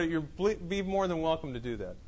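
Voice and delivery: ordinary delivery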